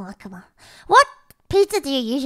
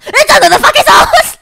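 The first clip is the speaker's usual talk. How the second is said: higher, louder, cracking into laughter; laughing mock shout; 14 dB louder than usual. American TikTok dude, laughing loud shout. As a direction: blurt it out laughing, as if at the end of his rope. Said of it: "the American dude is like".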